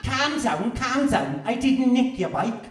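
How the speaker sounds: loud, high, laughing mock Scouse accent; hammed-up Liverpool calm down. Poet mocking accents, laughing Scouse impression. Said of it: "I canna do accents".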